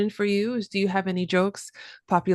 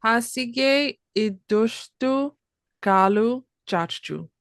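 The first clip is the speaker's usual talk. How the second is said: slow, effortful Hasige iddashtu kalu chachu; careful learner repeat; 3 semitones higher, much slower than usual. Shown as a demonstration. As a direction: sound out each word carefully. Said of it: "Can I try to say it after you".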